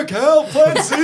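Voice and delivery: loud mock cry for help; panicked yell. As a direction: yell for help, half laughing. Player yells in panic.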